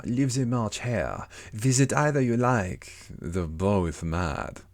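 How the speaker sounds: slow, drawn-out cat voice; Cheshire Cat. Acted. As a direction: stretch the words out like a leisurely storybook cat. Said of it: "the cat said".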